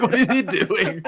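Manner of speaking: high, slow, laughing disbelief; amused bewilderment; baffled. Retold or imitated. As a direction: chuckle through the puzzled question. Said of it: "I'm like".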